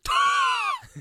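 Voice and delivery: very high, loud mock screech; piercing imitation of an alien scream; imitated alien shriek. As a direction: let out a short freaky creature cry.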